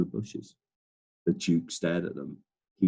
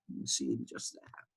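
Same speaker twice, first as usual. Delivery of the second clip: usual speaking voice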